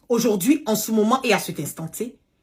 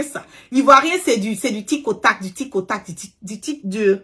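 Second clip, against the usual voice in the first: usual speaking voice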